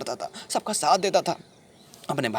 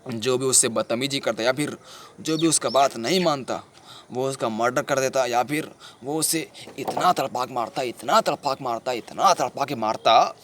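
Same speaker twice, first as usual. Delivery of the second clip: ordinary delivery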